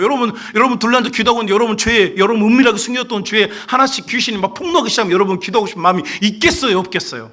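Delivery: loud, high, rapid-fire preaching; forceful preaching. Real, not acted. Preacher tells congregation of exposed sins, urgent loud preaching.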